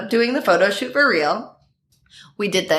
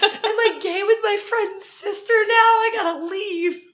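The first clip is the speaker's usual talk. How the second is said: much higher, hammed-up frantic voice; mock panic; 13 semitones higher than usual. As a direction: fret aloud like a cartoon panic. Panicking, about to flee.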